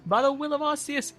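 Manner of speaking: high, loud, hammed-up sermon line; preacher's proclamation. Champion crediting a god in the speech, preaching. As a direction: proclaim it to the faithful. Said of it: "I don't know why I'm preaching".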